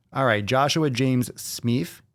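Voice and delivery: put-on drawn-out accent, Smith as "Smeef". Host reading a viewer's name aloud, put-on accent. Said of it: "I'm on this accent tick".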